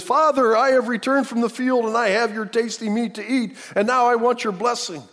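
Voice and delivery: high, loud acted call to father; eager announcement. Esau presenting the meal to his blind father, eager announcement.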